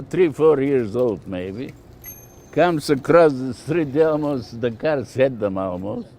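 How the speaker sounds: ordinary delivery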